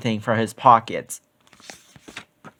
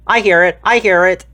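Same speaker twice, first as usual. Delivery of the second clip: excited; 4 semitones higher, 4 dB louder than usual. A narrator's reading. Danny hears the phone ringing.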